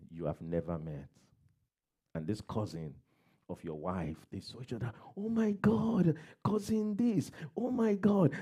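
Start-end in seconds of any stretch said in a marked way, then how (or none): 5.1-8.3 high, excited reunion squeal; giddy squeal. Retold or imitated. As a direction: squeal like long-lost cousins meeting. Cousins meeting, giggling together.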